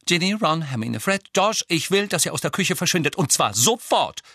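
high-pitched, insistent command; stern maternal order. A narrator's reading. Mother sending her children out of the kitchen, stern command.

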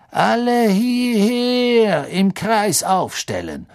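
high, loud, long-drawn order; stretched, carrying schoolmaster's command; commanding call. A narrator's reading. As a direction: summon the whole class imperiously. Teacher orders the class to gather.

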